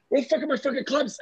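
put-on fast, high-pitched Finnish-accented outburst; mock an angry Finnish player storming in. Star player storming in demanding his golf clubs, Finnish accent. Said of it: "like, in a Finnish accent".

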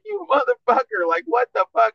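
high-pitched imitation of an indignant outcry; mock outraged victim. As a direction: mimic her shocked protest way up high. Imitating woman hit in the eye.

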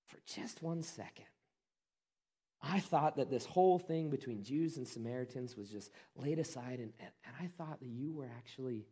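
a retold woman who has given up, quiet and low; defeated. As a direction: let the hope drain out of her as she speaks. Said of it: "she just kinda gets defeated".

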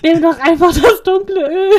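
laughing through a loud plea; half-laugh, half-yell the advice; laughing exasperation. Retold or imitated. Telling Dylan to take the dark oil, laughing loudly.

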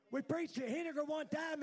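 normal talking voice

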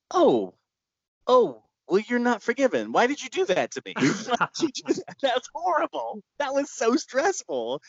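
high, wounded mock disbelief; mock outrage. Filmmaker answering an apologizing caller in high mock outrage. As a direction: whine it up high, hurt and amazed.

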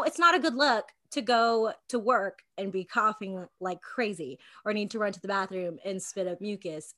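ordinary talk

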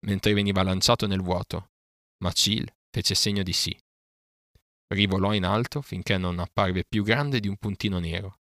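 plain reading voice

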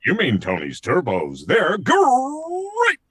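rolled, growling GREAT leaping up high; big cartoon tiger mascot catchphrase; Tony the Tiger. Showing the producers he can do it, tiger mascot voice. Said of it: "And I said".

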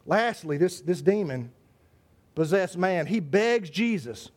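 ordinary talk, nothing marked

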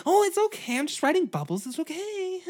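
put-on very high, airy girl voice; girly horse girl. Horse girl answering her friend, very high and airy. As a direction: ham up a dreamy horse girl.

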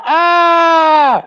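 a big open "Ah!" in place of a laugh, pitched high. Shown as a demonstration. Showing how an Argentine would laugh with a silent H, a big high "Ah!". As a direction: laugh out one long high "Ah!". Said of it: "Así me reiría".

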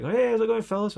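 high-pitched friendly hail; lightly acted pub hello to strangers; cheery stranger's greeting. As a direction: call out a chummy greeting. Voicing a stranger's greeting in anecdote.

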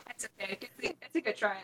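ordinary talk

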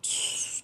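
soft unvoiced tss of the doors sliding; hissing sound effect. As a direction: hiss the doors closing slowly. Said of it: "Los efectos de sonido bien mamalones". (siseo suave de puertas)

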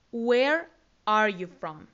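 very slow, each word set apart; slow model phrase. Shown as a demonstration. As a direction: spell out the question word by word for learners. Teacher models question slowly. (pregunta modelo muy lenta, palabra por palabra)

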